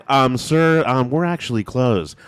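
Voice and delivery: mock worker calling back over the order; drive-through worker.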